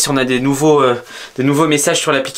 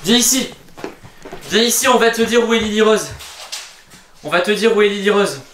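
calling out; 7 semitones higher than usual. Acted. Calling the monster over.

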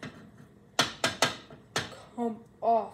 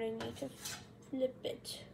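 normal talking voice

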